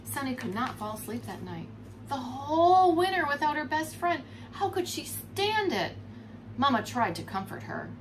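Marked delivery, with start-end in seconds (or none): none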